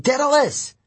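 high-pitched shout of a name; urgent yell. A narrator's reading. Hero calling to the inventor amid the fire, yelling. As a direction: yell it out in alarm. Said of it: "I yelled".

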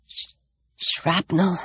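soft, weak, breathy murmur; whispered. Acted. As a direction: barely breathe the word out. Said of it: "he whispered".

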